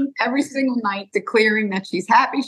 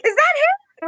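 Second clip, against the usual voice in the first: excited; slower than usual. Retold or imitated. Sister sees the regrowth photo.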